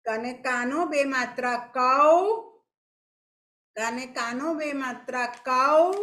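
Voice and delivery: ક અને કાનો બે માત્રા કૌ, slow and stretched, rising on કૌ; slow spelling aloud. Shown as a demonstration. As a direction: dictate it slowly to the class, rising on કૌ. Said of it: "ફરીથી આપણે બોલશું". (બાળકોને ધીમે ધીમે બોલાવતી શિક્ષિકા)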